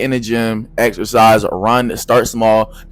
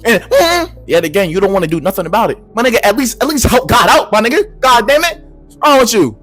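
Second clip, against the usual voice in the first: higher, mocking mm-hmm then urging; higher exasperated nag; sarcastic scolding; 8 semitones higher than usual. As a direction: scold them for praying and sitting. Scolding prayer without action.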